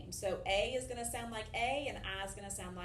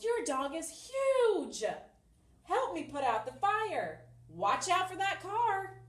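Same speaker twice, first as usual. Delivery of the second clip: higher and louder, stretched out like an exclamation; exclamatory; 7 semitones higher, 5 dB louder, slower than usual. Shown as a demonstration. Teacher demonstrating exclamatory sentences with feeling. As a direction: put strong feeling into each example. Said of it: "show strong feeling".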